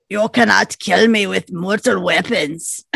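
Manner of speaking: Austrian-accented action-hero impression; Arnold Schwarzenegger. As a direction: do a lightly hammed-up Arnold accent. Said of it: "sounds like Arnold Schwarzenegger when he says".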